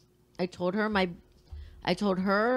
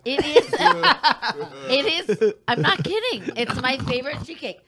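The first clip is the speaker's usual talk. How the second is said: laughing; 5 semitones higher, 6 dB louder than usual. Real, not acted. Laughing through the cake defense.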